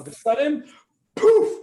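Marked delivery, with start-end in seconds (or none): 1.1-1.6 very high, loud poof; loud magic poof. Acted. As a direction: burst it like magic.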